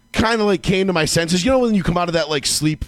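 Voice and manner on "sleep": ordinary delivery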